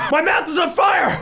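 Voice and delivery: high, strained cry; pained shout. Real, not acted. Eater checks if he can breathe fire, shouting. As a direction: yell it out in burning pain. Mouth burning from chip.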